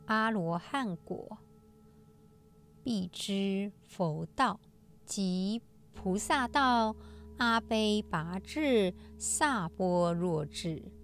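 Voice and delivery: slow, measured scripture reading; sutra recitation. Acted. Host reciting today's sutra passage to listeners, solemn recitation.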